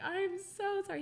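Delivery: high embarrassed sorry; mortified apology. Retold or imitated. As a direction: pitch the apology way up in shock. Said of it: "I was like".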